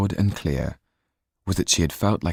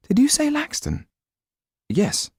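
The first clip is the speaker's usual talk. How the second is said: high shocked question; amazed, voice rising high; startled young woman. A narrator's reading. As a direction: jump at the name. Sido startled.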